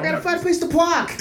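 high mock Boston accent; Boston accent imitation. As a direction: ham up a Boston accent to tease. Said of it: "the Boston accent always comes out of [name]".

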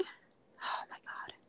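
very soft, slow, voiceless whisper; hushed, drawn-out whispered exclamation; awed whisper. Real, not acted. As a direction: whisper it slowly in disbelief. Overjoyed about a connected bathroom.

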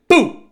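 sharp high "pum" blast; loud mock gunshot. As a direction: fire it like a sniper shot. Imitating sniper shot. (tiro imitado em voz alta)

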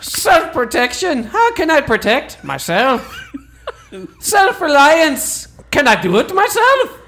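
high, hammed-up Irish indignation; angry Irish accent. Irishman working through his selfie list, mock-angry Irish accent. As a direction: rant through it as a comically aggrieved Irishman. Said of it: "get more angry with them as I go along".

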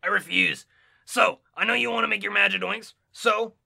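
loud, rough gravelly voice. Acted. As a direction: refuse defiantly in a growl. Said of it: "Stop doing gravelly voices".

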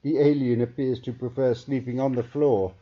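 a lightly voiced alien observer; scientist voice. A narrator's reading. Scientist reporting to colleague, lightly voiced.

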